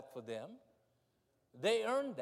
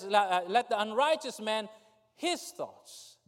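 preaching scripture; 5 semitones higher, 6 dB louder than usual. Acted.

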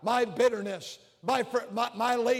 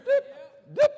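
phone beep; 5 dB louder than usual. Retold or imitated. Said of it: "whatever the sound it makes".

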